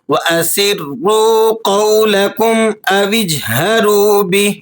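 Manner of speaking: Quranic recitation. Shown as a demonstration. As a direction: chant the verse with tajweed. Teacher recites verse. (تجوید کے ساتھ تلاوت)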